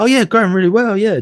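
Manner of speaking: hammed-up upbeat enthusiasm, pitch soaring. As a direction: gush it like someone annoyingly chipper. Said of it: "when someone's upbeat".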